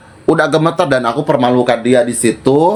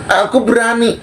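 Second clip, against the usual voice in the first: higher, slow, put-on boastful voice; mocking imitation; 8 semitones higher, slower than usual. Imitating the rival's fake bravado, slow, high and mocking. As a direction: mimic her acting brave. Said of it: "Tapi sok-sokan".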